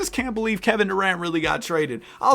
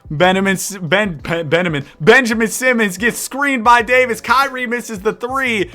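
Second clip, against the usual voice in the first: live sports commentary, rising with the action; energetic announcer call; play-by-play; 3 semitones higher, 5 dB louder than usual. Acted. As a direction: call the game like a broadcaster. Live play-by-play of game sim.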